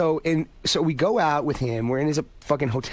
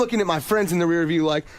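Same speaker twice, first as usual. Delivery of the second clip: ordinary talk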